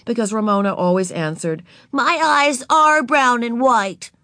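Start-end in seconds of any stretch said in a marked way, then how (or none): 1.9-4.1 put-on high, slow childlike voice; little girl voice. A narrator's reading. Narrator voicing Ramona to Beezus, high and childlike. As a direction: play four-year-old Ramona, proud.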